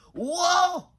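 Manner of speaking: high loud whoa; loud high surprised cry; startled shout. A narrator's reading. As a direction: jump back in shock. Fisherman startled.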